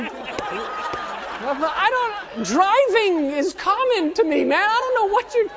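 high, loud, hammed-up yelling; exasperated protest. Student pilot protesting to his yelling instructor, exasperated shout. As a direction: shout back in helpless frustration.

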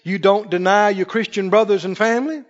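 usual speaking voice

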